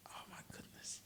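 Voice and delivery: hushed, voiceless whisper. Retold or imitated. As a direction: retell it in an awed whisper, pastor-style. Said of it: "he starts whispering".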